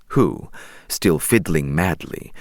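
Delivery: ordinary delivery, nothing marked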